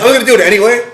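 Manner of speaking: loud, high, gritty resolve; determined shout. Retold or imitated. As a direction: belt it out with grit. Retelling resolve to push through.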